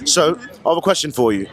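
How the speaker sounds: put-on London "All right then"; London accent. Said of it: "doing the accent".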